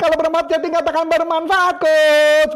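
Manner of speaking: rally the audience; calling out to chat. Real, not acted. Asks chat to respond. (ajakan lantang ke penonton)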